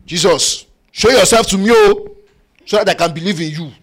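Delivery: preacher mocking a listener's demand that Jesus appear, whiny and loud; mock demanding cry. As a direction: ham up a petulant plea to heaven. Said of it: "I'm doing a caricature of the posture of your heart".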